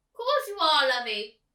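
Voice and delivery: put-on high, loud fluttery old lady calling; a theatrical old actress asking after her friend. Old actress calls out to the girl, high and loud. Miss Forcible voiced high.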